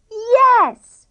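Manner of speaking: high, loud happy yes; boy answering happily. A narrator's reading. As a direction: say it delighted. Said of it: "said Denzel happily".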